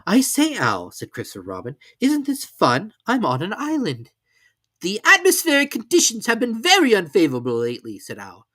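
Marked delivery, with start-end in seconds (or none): 0.0-0.9 put-on high, eager boy's voice; an excited little boy; Christopher Robin voice. A narrator's reading. 2.0-4.1 put-on high, eager boy's voice; an excited little boy; Christopher Robin voice. A narrator's reading. 4.8-8.0 a pompous owl calling from the air. A narrator's reading. Owl voiced in story.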